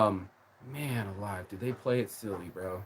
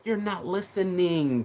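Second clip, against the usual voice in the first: mock scolding; 6 semitones higher, slower than usual. Imitating her scolding.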